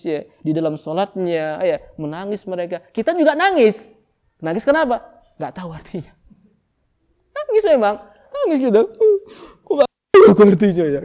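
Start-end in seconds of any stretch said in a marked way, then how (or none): 2.9-8.8 high and loud, hammed-up exclaim; mock lament. Preacher mocks crying without understanding, high and loud. Mocking those who weep unknowing. 9.6-11.0 fast, loud hammed-up wail; mock crying. Preacher acts a worshipper crying, not understanding. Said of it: "Kan gitu".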